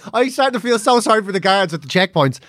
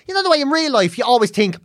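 normal talking voice

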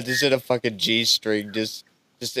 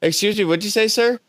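rising high, pointed question; suspicious tourist; 10 semitones higher, much faster than usual. Acted. Tourist to the host who muttered, high and suspicious. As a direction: get higher and pushier to the end.

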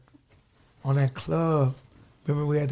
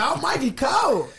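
trying too hard cool; 9 semitones higher than usual. Retold or imitated. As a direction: shout the name like showing off. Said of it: "But I be like".

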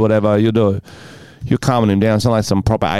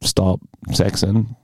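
normal talking voice

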